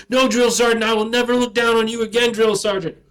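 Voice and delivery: loud, high barked answer to the sergeant; shouted recruit reply. Retold or imitated. Recruit shouting back at his drill sergeant. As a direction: yell it out at attention.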